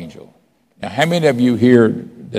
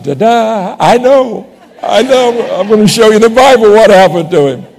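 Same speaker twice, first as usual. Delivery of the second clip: high, loud, laughing I know; laughing triumph; 12 semitones higher, 8 dB louder than usual. Retold or imitated. Pastor retelling his reply to the Witness, laughing triumph. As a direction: crow it back with a laugh.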